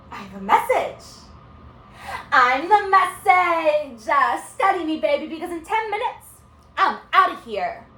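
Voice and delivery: high, loud, cocky student; brash and emphatic, words stretched for show; unruly student. Acted. Unruly student in a two-person play.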